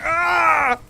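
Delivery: high, loud "Ah!" held out; sudden loud mock scream; imitated scream. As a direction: mimic someone screaming at full pitch. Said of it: "I don't know who just screamed then".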